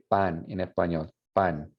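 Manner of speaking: pan with an unaspirated Spanish P. Shown as a demonstration. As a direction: say "pan" with little to no air on the p. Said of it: "you should feel little to no air".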